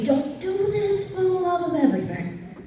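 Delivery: high, slow mock plea; hammed-up imploring warning; pleading warning. Quoting her warning stories.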